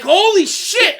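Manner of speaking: high, loud amazed yell; stunned fan exclaiming; shocked shout. Retold or imitated. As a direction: blurt it out in disbelief.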